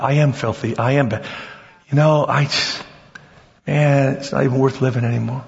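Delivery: low, defeated self-condemnation; mock despair, sunk low in the voice; acted dejection. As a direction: play a beaten-down sinner, pitched low. Acting out a believer accepting accusation.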